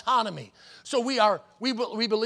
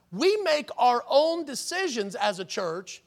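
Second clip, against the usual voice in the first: normal talking voice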